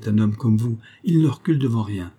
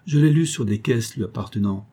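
usual speaking voice

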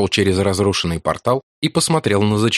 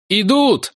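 breathless warning; 12 semitones higher than usual. Acted. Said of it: "выдохнул он".

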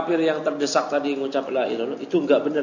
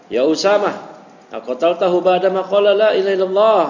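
raised, pressing voice, a bit louder; cornering question; 5 semitones higher, 5 dB louder than usual. Retold or imitated. Prophet pressing Usama about the killing, cornering and insistent. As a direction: push him into a corner with the question. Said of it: "itu memojokkan".

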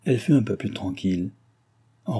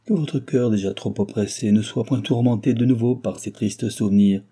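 usual speaking voice

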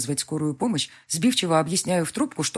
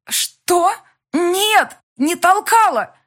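higher, louder yelling; shouted argument; 9 dB louder, much slower than usual. A narrator's reading. Women shouting denial and accusation, furious. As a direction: fling the words back.

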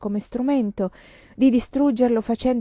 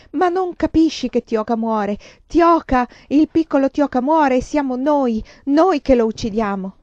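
higher, louder anguished outburst; crying out desperately; 5 semitones higher, 5 dB louder than usual. A narrator's reading. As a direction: plead in desperation, driving each phrase. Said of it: "gridavo, singhiozzavo". (grido disperato e concitato)

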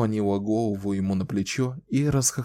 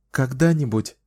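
put-on smiling young woman; 4 semitones higher, 3 dB louder than usual. A narrator's reading. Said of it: "улыбаясь старику".

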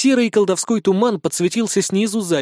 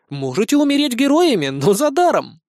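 cruel joke; 8 semitones higher, 3 dB louder than usual. A narrator's reading. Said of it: "жестоко пошутил полководец".

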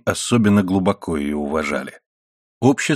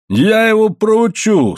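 high, slow, raised-voice threat; threatening father; 12 semitones higher, 4 dB louder, slower than usual. A narrator's reading. Angry miner vowing to punish his son, threatening. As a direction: drag out the threat, pitched way up. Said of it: "сказал Моррелл".

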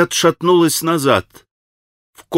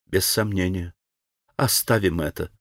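softer, lower, curt; curt cut-off; 6 semitones lower, 7 dB quieter than usual. A narrator's reading. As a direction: shut the topic down flatly. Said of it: "отрезала она".

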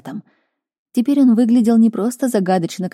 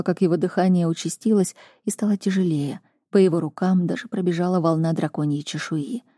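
plain reading voice

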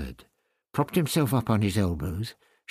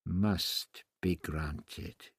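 softer and very slow, repeating it to himself; quiet repeat; 5 semitones lower, 7 dB quieter, much slower than usual. Acted. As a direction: murmur it again slowly, under the breath. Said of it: "he reiterated quietly".

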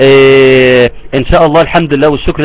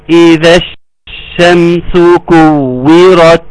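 slow, drawn-out tajweed recitation; Quran recitation; much slower than usual. Shown as a demonstration. Teacher reciting the verse for students, slow tajweed recitation. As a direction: recite it slowly, holding the joined sheen. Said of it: "وتقرأ".

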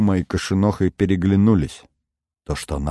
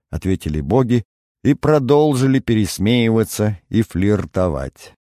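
ordinary talk

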